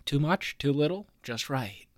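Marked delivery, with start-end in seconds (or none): 1.2-1.9 mock-secret whisper. Answering his own question in a whisper.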